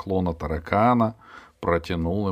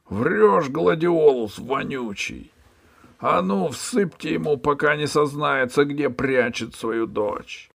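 high, loud shouting tirade; angry boss; 8 semitones higher, 4 dB louder than usual. Acted. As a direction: berate the cringing ferryman. Hunchback orders whipping. (высоко и громко, злобно)

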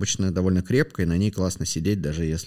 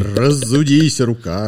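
drawn-out, louder mock heave; hammed-up exertion; 3 semitones higher, 5 dB louder, much slower than usual. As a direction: stretch it out with exaggerated effort. Hammed-up wind-up to a big thrust. (наигранно, с размахом)

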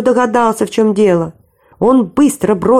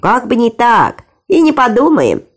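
firm voice; 3 semitones higher than usual. A narrator's reading. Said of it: "твёрдым голосом ответил Наф-Наф".

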